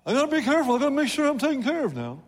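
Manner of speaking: high, put-on fretting voice; hammed-up self-protective excuse; mock cautious giver. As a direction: mimic someone anxiously looking after themselves, pitched well up.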